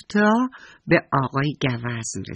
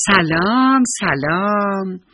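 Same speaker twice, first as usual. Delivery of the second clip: higher, loud hello with the second one drawn out; loud greeting; 5 semitones higher, 6 dB louder, much slower than usual. A narrator's reading. Little bear greeting the old squirrel, loudly. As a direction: call out a ringing hello. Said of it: "با صدای بلند گفت".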